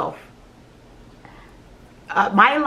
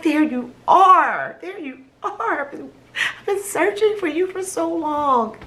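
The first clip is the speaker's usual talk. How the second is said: tender relief; 7 semitones higher than usual. Retold or imitated. Finding herself in mirror.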